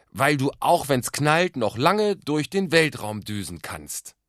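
slow, loud, high singsong recital; Gedicht aufsagen. A narrator's reading. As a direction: declaim it line by line like a child. Boy recites the poem to guests. (laut, hoch und langsam vorgetragen)

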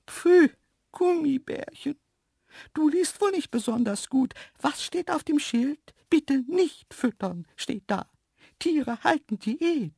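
very high, disdainful animal voice; cheeky scolding antelope. A narrator's reading. Antelope refusing the man's gummy bears, scolding. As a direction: lecture in a high, snooty antelope voice.

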